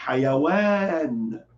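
"Hayawan" with the second A stretched long. Shown as a demonstration. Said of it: "Estica o A". (esticando o A de "Hayawan")

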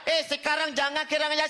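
high, fast scolding; mock angry. The angry man tells him again, in hammed-up anger. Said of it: "Lagi dia marah".

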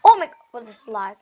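loud exasperated outburst at lag; frustrated yell. Real, not acted. Gamer yelling about lag.

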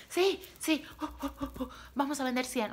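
high, pumped-up self-motivation chant; hyped-up cheering. Retold or imitated. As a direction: re-enact leaving the meeting all fired up. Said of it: "¿y saben cómo salí? Así de".

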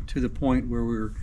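ordinary talk, nothing marked

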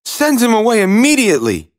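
high, quick princess order; high-pitched indignant storybook princess; commanding princess. A narrator's reading. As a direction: dismiss him urgently in a high voice. Princess orders frog sent away.

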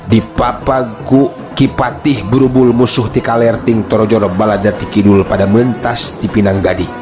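ordinary delivery

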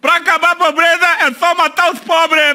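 high-pitched mock Bolsonaro; Bolsonaro impression. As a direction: rant as Bolsonaro.

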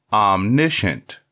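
"omniscient" said slowly and clearly as AHM-NISH-ənt, falling at the end; careful model reading of "omniscient", stress on the first syllable; slow clear dictionary pronunciation. Shown as a demonstration. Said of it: "The word is pronounced as".

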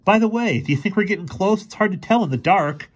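high-pitched character voice for Fergie; Fergie, winded but chatty. A narrator's reading. Fergie asks the professor if they're close, high-pitched and querying. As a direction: ask in a high, lively voice.

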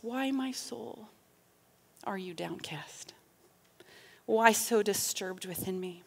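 very soft, low, near-whispered psalm; hushed refrain. Acted. Final chorus to one's own soul, hushed. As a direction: say it inward, hushed, with pauses.

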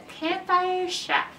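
usual speaking voice

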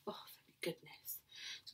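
soft, breathy whisper of relief. Real, not acted. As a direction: murmur your relief. Relieved aside to listening class.

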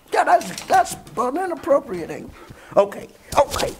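very high, squeaky put-on voice for the dog; playful squeaky voice for the dog; voicing the pet. Dog voice continues.

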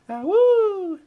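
high, loud wolf pup howl. Pretend. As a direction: throw back your head and howl. Wolf howl after she-wolf joke.

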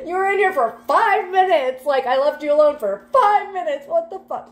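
hammed-up parent meltdown; exasperated parent. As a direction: scold the kid who wrecked the bathroom in minutes. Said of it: "Like, that's the energy".

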